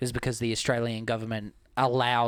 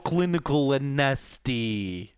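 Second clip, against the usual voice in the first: slow mock Russian accent; 3 semitones higher, 3 dB louder than usual. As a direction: needle someone in a drawn-out Russian accent. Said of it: "that's how you can funnel your Russian accent".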